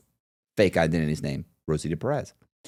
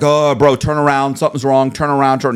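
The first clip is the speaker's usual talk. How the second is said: louder, higher urgent call to turn back; urgent warning; 5 semitones higher, 6 dB louder than usual. Retold or imitated. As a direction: warn your buddy off, pressing hard. Urging friend to leave bank.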